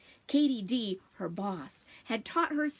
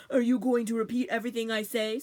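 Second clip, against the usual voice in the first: mimicking; 4 dB louder, faster than usual. Mister Bunny mimicking her.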